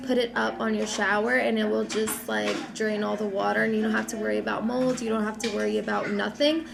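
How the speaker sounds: ordinary talk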